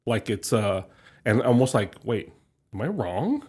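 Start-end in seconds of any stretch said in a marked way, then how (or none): none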